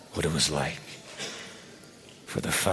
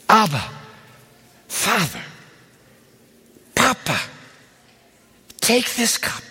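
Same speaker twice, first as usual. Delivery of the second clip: pleading prayer; 4 semitones higher than usual. Acted. Jesus begging his Father to take the cup away, pleading. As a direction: beg the father, voice sinking to a whisper. Jesus' Gethsemane plea voiced.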